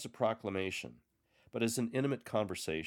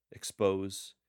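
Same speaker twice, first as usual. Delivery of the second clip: ordinary talk, nothing marked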